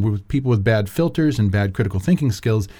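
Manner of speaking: ordinary talk, nothing marked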